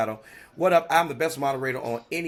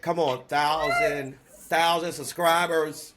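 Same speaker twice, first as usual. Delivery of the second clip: slow hype; slower than usual. Real, not acted. Host hyping the thousand subscribers, slow and savoring. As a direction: stretch it out slowly, savoring it.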